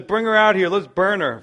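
acted Judah calling for her death; mock furious order. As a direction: bark it like a hypocrite judge.

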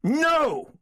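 high, defiant cry; high-pitched, flat-out refusal; furious refusal. A narrator's reading. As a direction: reject the entreaty with one high burst. Refuses brother's entreaty again.

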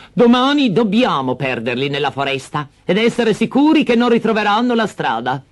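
high, hard plotting voice; cruel stepmother. Acted. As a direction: insist coldly. Stepmother plans again.

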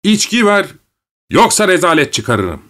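high-pitched threatening yell; shouting. A narrator's reading. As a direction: shout the demand from a high start. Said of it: "yeniden bağırmaya başladı".

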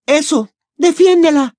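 high, loud sarcastic snap; snapping. Acted. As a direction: spit it out at him. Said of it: "le espetó Ginny".

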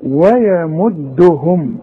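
ويمدهم drawn out slowly, light waw and ya; tajweed demo of ويمدهم; light recitation. Shown as a demonstration. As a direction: stretch ويمدهم slowly, letters thin. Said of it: "طلعها مضمومة".

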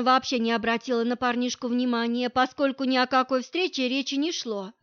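plain reading voice

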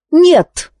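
high, abrupt denial; sharp, final no; curt refusal. A narrator's reading. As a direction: cut it off in one word. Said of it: "отрезала она".

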